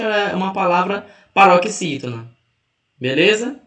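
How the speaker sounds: usual speaking voice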